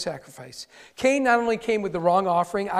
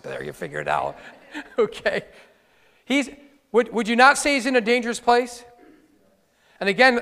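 normal talking voice

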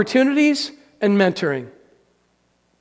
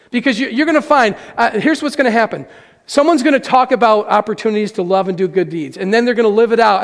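ordinary talk, nothing marked

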